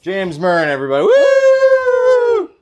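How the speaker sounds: loud announcer hype for the guest; hype intro. Real, not acted. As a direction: pump up the crowd with a big whoop.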